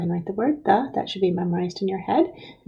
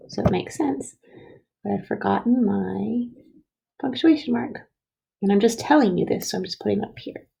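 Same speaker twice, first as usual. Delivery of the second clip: ordinary delivery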